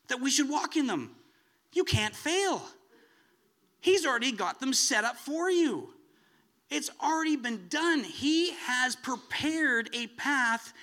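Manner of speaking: high, loud preacher's fervour; preaching at full force. Acted. Preacher to congregation, booming and fervent. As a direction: rise and thunder the promise to the congregation.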